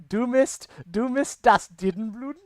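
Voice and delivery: hammed-up fake German. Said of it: "it has in fake German".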